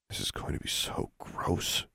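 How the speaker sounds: hushed, whispery mutter. A narrator's reading. Disgusted girl to herself over a potion, muttered. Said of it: "she muttered".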